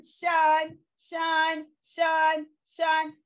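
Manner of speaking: Sean said as a clipped shun sound, repeated; tion sound shun. Shown as a demonstration. Teacher showing the class what tion says. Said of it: "T I O N says".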